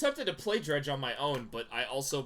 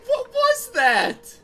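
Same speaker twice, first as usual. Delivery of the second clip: slow, much higher and louder disbelief; incredulous high shout; 12 dB louder, much slower than usual. Real, not acted. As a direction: drag it out in baffled disbelief. Stunned by abrupt ending.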